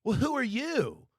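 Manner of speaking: mild mock snooty party girl; haughty woman. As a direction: ask it like she owns the room. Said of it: "she sits there and goes".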